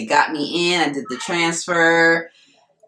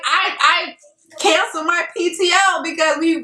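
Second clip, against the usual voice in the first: flustered excitement; 11 semitones higher than usual. Retold or imitated.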